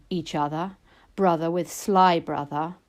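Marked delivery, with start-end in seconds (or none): none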